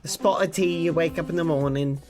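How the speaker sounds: casual British accent without affectation; lightly done British accent, chatty and relaxed. Shown as a demonstration. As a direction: talk like an everyday British lad. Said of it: "If I was from the U K, what would I sound like?".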